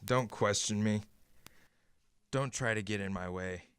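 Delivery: low, hushed, honeyed reassurance; calm her down, and keep an edge of control; soothing. Acted. Harry turns soothing yet controlling.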